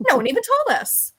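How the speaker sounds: indignant disbelief, hammed-up; mock surprise. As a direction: play up being scandalized by the news. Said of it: "It's like, wait, what?".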